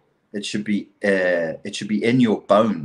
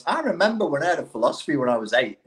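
higher, quicker put-on voice; quick, pitched-up imaginary quote; mock teenage reminiscing; 6 semitones higher, faster than usual. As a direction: lightly act out a reminiscing youngster. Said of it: "when you're eighteen, you're not going".

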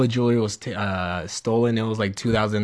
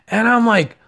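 usual speaking voice

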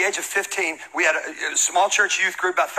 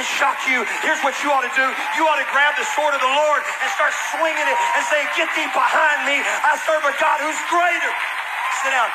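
preaching; 7 semitones higher than usual. Acted. Pastor rousing the church to fight back, full preaching. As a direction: preach it to the rafters.

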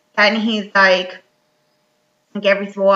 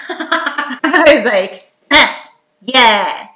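laughing; 2 semitones higher, 5 dB louder, much slower than usual. Real, not acted. Laughing at the rhyme.

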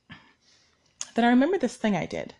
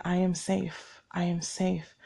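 softer and softer, near a whisper; calm yourself with each repeat, fading; soft self-soothing mantra; 2 semitones lower, 7 dB quieter, slower than usual. Retold or imitated. Alone at home, calming her anxiety: soft fading mantra.

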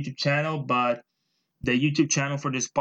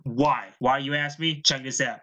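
normal talking voice

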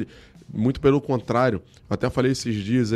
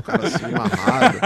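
laughing; 10 semitones higher, 5 dB louder than usual. Real, not acted. Describing his grumpy face.

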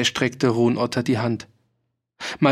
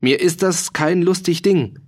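higher-pitched, annoyed protest; indignant; 6 semitones higher than usual. A narrator's reading. Farmer objecting that this is no joke, indignant. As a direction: object to being laughed at. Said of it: "sagte Ruhn Otter unwillig".

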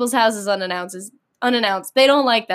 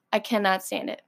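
ordinary talk

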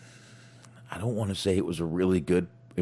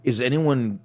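ordinary talk

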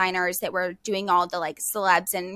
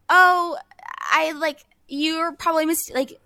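halting, higher-pitched retold disbelief; baffled stammer; 7 semitones higher, 3 dB louder than usual. New hire answers coworker about her dad, baffled stammer. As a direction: stumble over a thrown, confused reply, starting loud and high.

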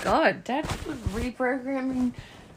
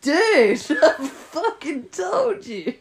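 loud, slow retort broken by laughter; laughing, exasperated; 4 semitones higher, 8 dB louder, much slower than usual. Retold or imitated. Mimicking her frustrated answer to him, loudly laughing. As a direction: laugh through an I-told-you-so.

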